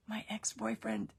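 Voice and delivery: hushed, breathy whisper, no voice. Retold or imitated. Whispering to Donnie so the ex won't hear. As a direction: whisper the embarrassing ask.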